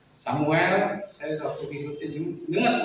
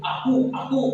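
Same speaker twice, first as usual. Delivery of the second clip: much higher, louder commanding "Aku, aku"; firm voice of God; 12 semitones higher, 4 dB louder than usual. Retold or imitated. God pressing Samuel in a dream, firm and high. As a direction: boom it out as God insisting. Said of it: "Tuhan tegas ngomongnya tuh".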